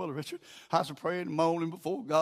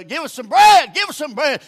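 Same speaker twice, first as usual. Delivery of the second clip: loud, higher-pitched begging; insistent plea; 9 semitones higher, 15 dB louder than usual. Acted. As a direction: call out for bread like a pestering neighbour. Acts out neighbour begging for bread.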